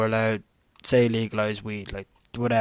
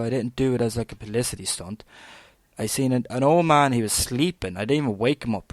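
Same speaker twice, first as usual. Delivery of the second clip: usual speaking voice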